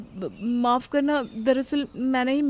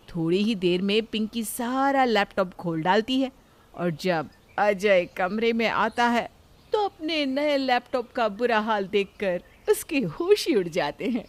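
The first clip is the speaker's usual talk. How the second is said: ordinary talk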